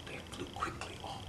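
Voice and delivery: hushed, airy whisper; a soft magical whisper. A narrator's reading. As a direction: breathe it like a secret. Magic feathers fly.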